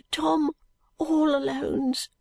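put-on high, slow boy's voice; sick street boy. A narrator's reading. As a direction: answer haltingly, high and drawn-out, as a poorly boy. Sick boy names his home.

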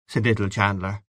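plain reading voice

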